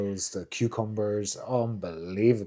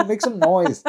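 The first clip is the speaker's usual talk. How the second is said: pleading exasperation; 6 semitones higher than usual. Retold or imitated. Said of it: "And I'm like".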